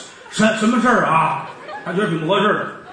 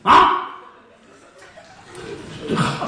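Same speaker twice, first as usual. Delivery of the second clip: ordinary delivery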